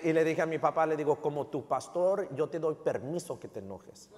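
normal talking voice

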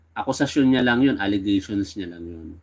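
normal talking voice

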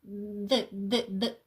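"д" repeated with the tongue between the teeth; teacher drilling "д, д, д" as a dental th-like sound; interdental D. Shown as a demonstration. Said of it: "Він між зубками".

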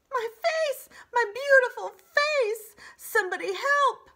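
high wailing fox cry, loud; howling in pain. A narrator's reading. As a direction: howl for help with a face full of quills. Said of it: "howling in pain".

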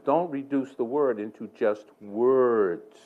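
slow, with a long drawn-out "words"; slow, emphatic admonition. Retold or imitated. Preacher repeating what God told him, slow emphatic admonition. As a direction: let "word" and "words" stretch out.

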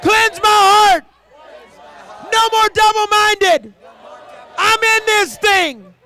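high, loud, slow shouted prayer; fervent shouted prayer; preaching at full force. Real, not acted. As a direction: cry it out to God.

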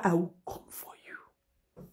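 slow, breathless whispered threat; menacing whisper. Acted. Warning the enemy who touched her mother, whispered. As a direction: hiss the promise low and slow.

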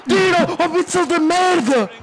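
high furious screaming; raging gamer. Real, not acted. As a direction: scream at the screen. Gamer screaming at conceded goal.

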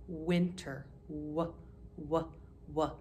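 isolated w sound, said softly and repeated; teacher isolating the w sound. Shown as a demonstration. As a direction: breathe out a soft w, w, w. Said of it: "listen to the first sound".

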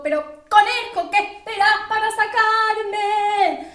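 loud, high, impatient yell; shouting. Acted. Drowning shadow to the rabbit who hasn't helped, shouting. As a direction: yell it urgently, drawing out the end. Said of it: "hasta que le gritó".

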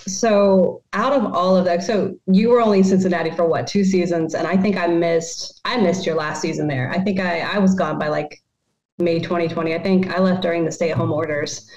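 usual speaking voice

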